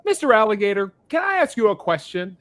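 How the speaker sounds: high, eager cartoon frog asking; wide-mouthed frog voice. Telling dad's joke to listeners, as a high eager frog. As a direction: squeak it up like a big-mouthed frog.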